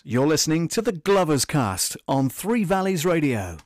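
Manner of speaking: loud announcer read, peaking; distortion. Acted. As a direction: push the mic into clipping. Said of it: "because it's peaking and I don't like it".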